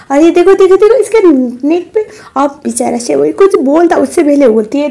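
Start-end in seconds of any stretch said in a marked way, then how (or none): 0.1-2.1 high, loud triumphant showing-off; gloating taunt. Acted. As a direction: crow over her rival. Mistress taunts wife, showing love bite.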